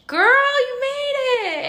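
very high, loud, drawn-out cheer; celebratory squeal. Retold or imitated. Cheering herself at arrival, high drawn-out squeal. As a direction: stretch it into a joyful squeal. Retold celebrating self-talk.

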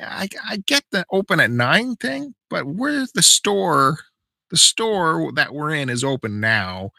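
high-pitched, drawn-out customer plea; exasperated reasoning. Retold or imitated. Customer arguing with employee that store is open, exasperated.

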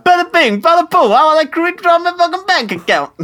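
put-on very high New York accent sliding into Borat; hammed-up, badly faked New York accent; failed New York accent. The Thing at the bank, botched high-pitched New York accent. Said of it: "That's more Borat".

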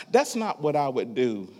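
ordinary delivery

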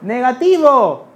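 high, loud, slow yell; stretched-out shout of relief; shouted from the bathroom. Retold or imitated. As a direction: call it out through the bathroom door. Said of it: "Nati va y grita".

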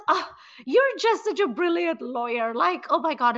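mock-admire the lawyer in a lightly put-on voice; half-hearted imitation of a gushing mother. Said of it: "the mother actually cackles out loud and she says".

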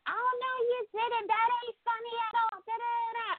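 very high-pitched mock outburst; Trisha going off. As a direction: imitate an indignant woman ranting, voice way up. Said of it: "Trisha went off yet again".

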